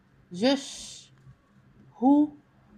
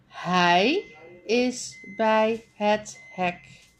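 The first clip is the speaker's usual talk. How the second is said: ordinary talk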